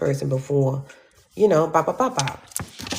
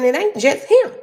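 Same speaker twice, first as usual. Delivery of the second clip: much higher, louder shout; 12 semitones higher, 6 dB louder than usual. Retold or imitated. Telling the judge off in a loud high shout. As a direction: yell it at the judge.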